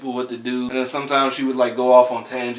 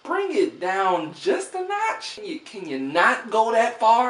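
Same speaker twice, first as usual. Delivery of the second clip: pleading; 9 semitones higher than usual. Retold or imitated. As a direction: beg gently for restraint, voice up high. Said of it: "where I was like".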